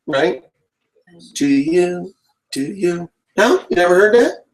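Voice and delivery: high, slow, drawn-out farewell; sing-song mock goodbye; silly sign-off voice. Hamming up goodbyes to viewers in a silly voice. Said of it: "I'm being entertaining".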